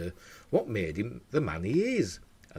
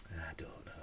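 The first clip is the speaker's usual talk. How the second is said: whispered shrug; 4 semitones lower, 18 dB quieter than usual. Real, not acted. Unsure about toothpaste.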